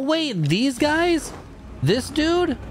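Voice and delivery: high, disbelieving questions; can't believe it's this guy again; incredulous. Real, not acted. Incredulous at returning enemy.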